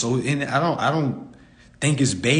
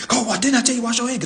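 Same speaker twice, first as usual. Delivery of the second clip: mock scolding parent; 10 semitones higher, 3 dB louder, faster than usual. Parent scolding kid, mocked.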